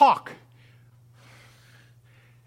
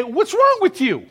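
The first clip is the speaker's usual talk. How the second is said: higher, stress on "wrong"; exasperated rebuke; 9 semitones higher, 4 dB louder than usual. Acted. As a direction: demand it like a boss. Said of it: "You would say".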